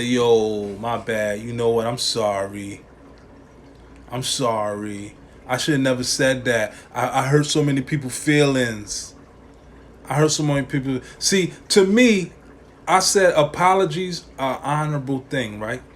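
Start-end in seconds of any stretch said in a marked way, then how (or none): 0.0-11.0 lower, softer, hammed-up sorry; mock remorseful apology. Celebrity caught out, fake-sorry to the public, lower and softer. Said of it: "and say".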